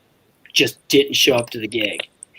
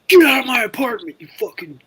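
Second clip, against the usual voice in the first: high, strained mock yell; mock outburst, jumping up in pitch; retold angry eviction; 8 semitones higher than usual. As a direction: imitate a furious host throwing someone out. Retold angry kicking out of apartment.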